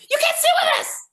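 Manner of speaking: put-on very high, sharp mean-girl squeal; mean girl quote. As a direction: fling it out like a snooty queen bee. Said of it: "She's got that famous".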